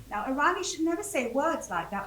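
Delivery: ordinary delivery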